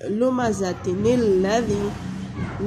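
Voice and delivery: "लुमज़तिनिल लज़ी" drawn out with joining nun; slow Quranic recitation. Shown as a demonstration. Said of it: "इसको वसल करेंगे".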